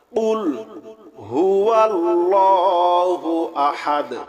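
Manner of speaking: slow, drawn-out melodic tilawat; Quran recitation. Acted. Teacher reciting Surah Ikhlas to students, slow melodic chant. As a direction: chant each word long and even, like a qari.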